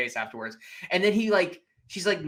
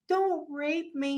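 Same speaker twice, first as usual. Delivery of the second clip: mock frightened plea; 10 semitones higher, much slower than usual. Retold woman pleading with attacker.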